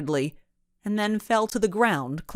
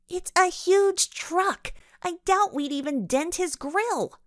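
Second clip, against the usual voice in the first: put-on girl character voice; 12 semitones higher than usual. A narrator's reading. Other girl answers.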